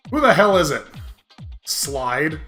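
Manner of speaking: ordinary talk, nothing marked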